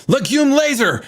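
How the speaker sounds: high-pitched mock attack yell; battle cry. Player throwing sharpened peanuts at enemies, mock battle cry. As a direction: yell LEGUME LASER like a silly special move. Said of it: "and I yelled out".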